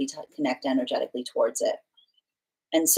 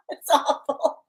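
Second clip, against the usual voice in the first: ordinary delivery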